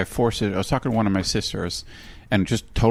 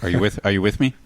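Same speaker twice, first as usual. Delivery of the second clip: ordinary talk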